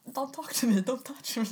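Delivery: loud, high mock protest; upset rebuff. Crying customer to repair man, acted upset rebuff.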